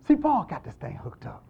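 usual speaking voice

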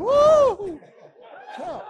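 high whooping yell, then a loud cry for help; hollering for help. Retold or imitated. Hunter stuck up the tree, hollering for help. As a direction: holler down from the treetop. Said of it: "he started hollering".

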